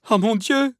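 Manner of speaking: very high, loud terrified exclamation; acted piercing appeal; heart-rending cry. A narrator's reading. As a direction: cry to God in sheer dread. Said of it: "s'écria-t-elle".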